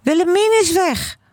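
put-on high, urgent child's cry; loud calling. A narrator's reading. Boy telling mother his sister is gone, high calling. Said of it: "roept Gijsbert heel hard".

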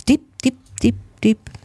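repeated word stepping down from high; echo. A narrator's reading. Echo far below the nest, fading repeats. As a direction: imitate an echo dying away. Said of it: "hoorde hij de echo".

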